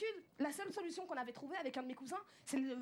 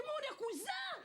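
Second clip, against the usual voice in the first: joyful exclaim; 11 semitones higher than usual. Retold or imitated.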